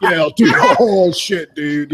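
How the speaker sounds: loud, hyped stoner bro, laughing; stoner dude. Acted. Guest mimicking stoner classmates, loud stoner dude voice. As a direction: blurt it like an amped stoner dude. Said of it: "Yeah, the stoner motherfuckers".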